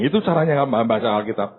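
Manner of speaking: usual speaking voice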